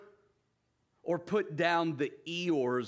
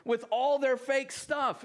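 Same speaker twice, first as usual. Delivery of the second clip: objecting listener; 8 semitones higher than usual. Retold or imitated. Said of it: "You say".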